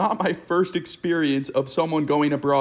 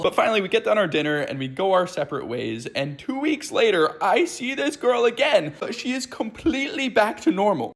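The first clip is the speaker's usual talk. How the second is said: normal talking voice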